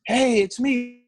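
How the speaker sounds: high imitated English accent. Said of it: "It was like an English accent".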